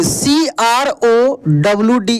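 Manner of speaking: letter by letter, slow and clear; slow, deliberate spelling of each letter. Shown as a demonstration. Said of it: "crowd".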